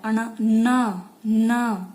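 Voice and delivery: न हल्का नाक से ज़ोर देकर; न को नाक से गूंजाकर बोलिए; nasal n sound. Shown as a demonstration. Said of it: "तो ये नाक से बोला जाता है". (नाक से साफ़ न बोलिए)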